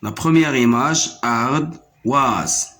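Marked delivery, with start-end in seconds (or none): 1.2-2.7 "ardoise" split in two, ar-doise; syllable by syllable. Shown as a demonstration. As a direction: pause in the middle of "ardoise". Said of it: "se trouve ici dans la deuxième syllabe". (« ardoise » en deux temps : ar… doise)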